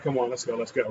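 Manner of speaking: low, soft coaxing to leave; low urging. Retold or imitated. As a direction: hush them out the door. Pulling friend away quietly.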